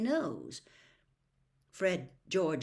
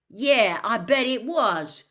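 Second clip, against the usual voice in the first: much louder, higher outburst; sudden; 6 semitones higher, 12 dB louder, slower than usual. A narrator's reading. Ron jumping in about the Malfoys, sudden outburst. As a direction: burst in all at once. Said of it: "said Ron suddenly".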